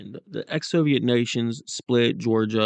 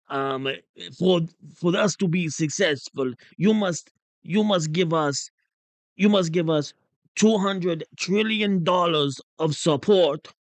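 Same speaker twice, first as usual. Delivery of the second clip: raised-pitch hammed-up Russian accent; put-on Russian accent, pitched higher, haggling; mock Russian accent; 6 semitones higher than usual. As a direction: play a pleading envoy in a comic Russian accent. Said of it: "make fun of my Russian accent".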